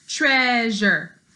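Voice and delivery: usual speaking voice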